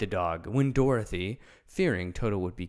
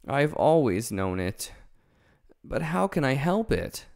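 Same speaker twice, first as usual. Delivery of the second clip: ashamed; 5 semitones higher, 5 dB louder than usual. A narrator's reading. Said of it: "hanging his head in shame".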